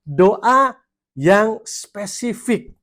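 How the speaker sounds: each word of "doa yang spesifik" drawn out, loud and high; very slow emphatic. Real, not acted. Pastor drives home the second point to the congregation, slow and emphatic. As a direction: spell out "doa yang spesifik" slowly, every syllable weighted.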